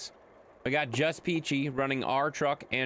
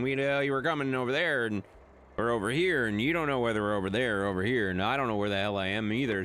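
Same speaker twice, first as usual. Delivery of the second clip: ordinary delivery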